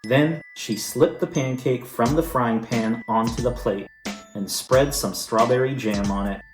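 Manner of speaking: plain reading voice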